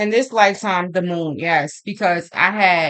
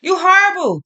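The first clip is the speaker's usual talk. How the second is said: quoting herself in a much higher, fast, indignant voice; mock outrage, pitched way up and rushed; high indignant protest; 11 semitones higher than usual. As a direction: squeal it back at him, offended and playful. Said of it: "And then I'm like".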